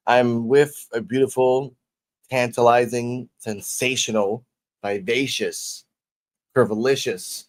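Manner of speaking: slow, drawn-out flattery; slow hype intro. Acted. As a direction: savor each compliment slowly. Slow flowery guest intro.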